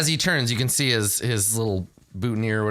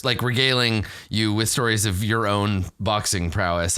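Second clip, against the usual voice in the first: ordinary talk, nothing marked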